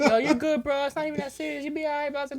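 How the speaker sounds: a hint of teasing kids; cool kids comforting. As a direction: imitate the kids cheering him up. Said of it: "they were like".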